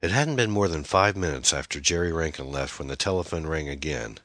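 usual speaking voice

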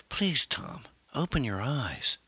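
high-pitched plea, slowing at the end; pleading wife. A narrator's reading. Wife urging husband to wake.

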